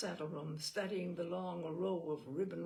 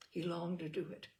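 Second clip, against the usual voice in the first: usual speaking voice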